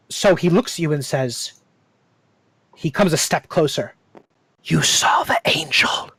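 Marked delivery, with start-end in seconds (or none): 4.6-6.1 slow hushed whisper. Acted. Harkwell stepping closer to Jonas, whispering. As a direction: breathe it, barely voiced, drawing close. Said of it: "NPC steps closer, whispers".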